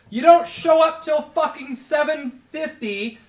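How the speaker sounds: high, loud furious rant; angry rant. Retold or imitated. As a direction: yell it, fed up. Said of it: "I was like".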